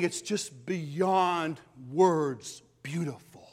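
slow, breathless whisper; slow whispered awe; awed whisper. Real, not acted. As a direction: hush your voice in wonder. Preacher hushed over heaven's beauty.

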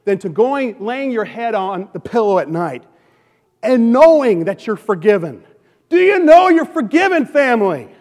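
high, loud preaching at full force. Acted. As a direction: drive it home to the congregation. Preaching climax on forgiveness.

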